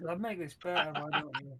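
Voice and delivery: ordinary talk, nothing marked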